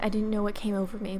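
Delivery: ordinary delivery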